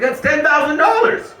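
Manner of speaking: high-pitched mock disbelief; incredulous protest. Husband arguing the price with his wife, incredulous. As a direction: plead the case, pitch shooting up. Acted disbelief at wife's refusal.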